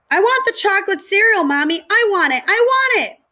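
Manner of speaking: high, loud bratty whine; whining child. Acted. Small child demanding cereal from mommy, high loud whine. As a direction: wail like a spoiled toddler.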